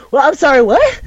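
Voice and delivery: can't believe what he just heard; freaked-out disbelief. Retold or imitated. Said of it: "Sukune just freaks the fuck out".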